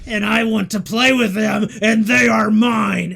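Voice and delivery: put-on high, loud wailing child; wailing tantrum. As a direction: sob and shout that they are hers. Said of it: "she begins to cry. And she begins to wail".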